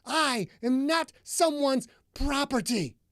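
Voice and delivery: high, loud and fast rant building; furious tirade. A narrator's reading. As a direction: hurl every line at him in rage.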